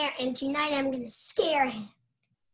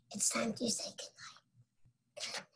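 hushed bedtime whisper, barely voiced; whispered goodnight; 3 semitones lower, 14 dB quieter, faster than usual. A boy whispering goodnight to end his bedtime story. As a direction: breathe the goodnight as if a child is falling asleep.

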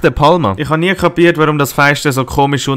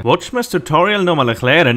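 ordinary talk

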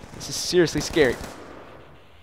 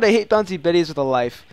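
ordinary delivery

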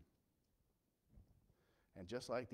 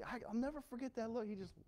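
usual speaking voice

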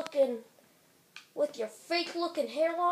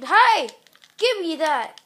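loud shout at a driver; shouting hey; 9 dB louder than usual. Real, not acted. Kid shouting at a driver while stealing a car, loud. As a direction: yell to grab it.

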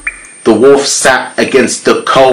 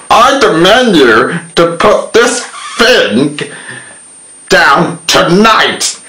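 pig demanding; 7 semitones higher than usual. A narrator's reading. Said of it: "The pig said to the cop".

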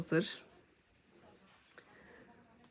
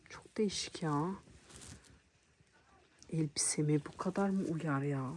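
whispering; 6 dB quieter than usual. Real, not acted. Whispered aside to camera.

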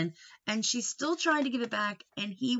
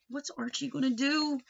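ordinary delivery

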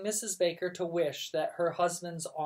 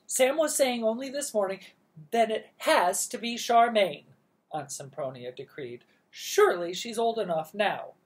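bossy aunt; 6 semitones higher, 7 dB louder than usual. Acted.

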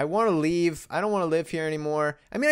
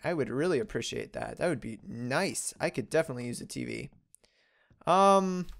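usual speaking voice

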